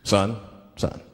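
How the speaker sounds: low, measured traffic-cop voice; mock-authoritative policeman; police officer. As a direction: question the driver low and official. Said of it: "the police pull me over".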